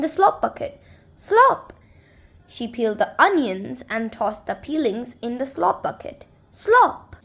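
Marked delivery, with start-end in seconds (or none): 1.2-1.7 high, loud "Slop!" sound effect; splashy slop. A narrator's reading. Reading to kids, the bucket lands: a high, loud "Slop!". 6.5-7.2 high, loud "Slop!" sound effect; splashy slop. A narrator's reading. Reading to kids, the bucket lands: a high, loud "Slop!".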